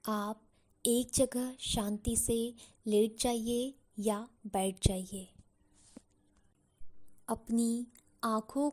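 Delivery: slow, unhurried meditation guide voice; guided meditation. Acted. Guide settles listeners with eyes closed, slow meditation voice. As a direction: ease them into calm with slow, spaced-out phrases.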